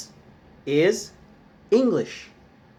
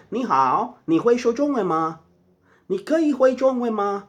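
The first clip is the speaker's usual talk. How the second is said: higher-pitched Mandarin demonstration; raised voice-pitch for sample Mandarin phrases; demonstrating Chinese; 6 semitones higher than usual. Shown as a demonstration. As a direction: show how Chinese sounds, pitched up. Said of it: "What does chino sound like?".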